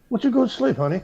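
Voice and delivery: sugary pretend tenderness; mock-sweet wife. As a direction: imitate a wife coaxing you to bed. Voicing a sinister-sweet wife.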